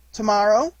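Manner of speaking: a lilting, eager little question; hopeful. A narrator's reading. Roo begging his mother for tomorrow, hopeful. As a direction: ask as if it might come true. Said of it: "said Roo hopefully".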